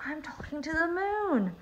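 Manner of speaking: high, hushed storybook thrill; excited bear thinking to himself. A narrator's reading. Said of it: "Bear got very excited".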